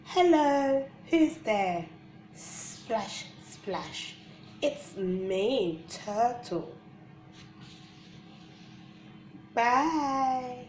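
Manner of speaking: bright, high sing-song read-aloud; reading to children. Acted. Reading a baby book aloud.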